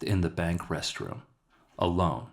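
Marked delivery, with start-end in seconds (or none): none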